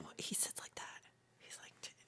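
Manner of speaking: gossipy whisper. Real, not acted. Streamer whispering about pole vault's habit. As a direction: whisper it like telling on him.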